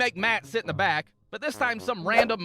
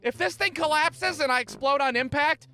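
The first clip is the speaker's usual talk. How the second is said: higher, anxious voice; mock nervous call; 6 semitones higher, 4 dB louder than usual. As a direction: pretend panic call home. Mock phone call to mom.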